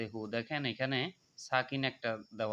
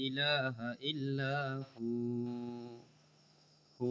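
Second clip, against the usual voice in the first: slow recitation; 3 dB quieter, much slower than usual. Shown as a demonstration. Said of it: "এক আলিফ টানতে হবে".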